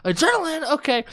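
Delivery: very high-pitched questioning voice; acted-out high puzzlement; baffled. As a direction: echo the word in mock confusion, way up high. Acting her confused response.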